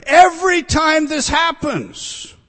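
high, loud and slow, punching every; stressing every. Acted. Preacher to congregation, every time stressed loud and slow. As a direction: hammer home Every with a loud, slow lift.

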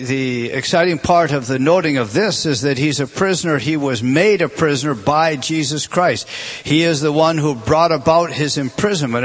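ordinary talk

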